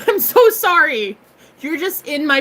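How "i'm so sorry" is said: mock apology.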